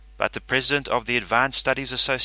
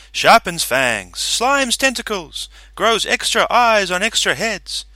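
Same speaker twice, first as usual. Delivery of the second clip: loud, high fairground barker hawking wares; carnival barker shout; 11 semitones higher, 8 dB louder than usual. Acted. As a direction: bellow it over a noisy crowd. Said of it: "no one could hear me in the din".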